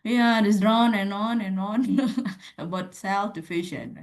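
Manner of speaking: slow, dragging bored voice. Shown as a demonstration. Teacher demos a bored reply to her class. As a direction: sigh it out like a bored student. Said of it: "Berarti dia bosen gitu ya".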